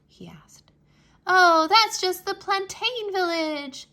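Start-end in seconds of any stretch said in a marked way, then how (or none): 1.3-3.9 high, loud and slow, offhand; cassava voice. A narrator's reading. Cassava telling Anansi about the next village, high and slow. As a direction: brush it off in a high sing-song. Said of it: "the cassava said".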